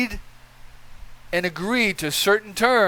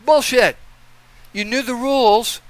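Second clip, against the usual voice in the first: loud, higher scornful outburst; angry; 5 semitones higher, 3 dB louder than usual. Real, not acted. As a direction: spit it out in disgust. Angry rant dismissing excuses.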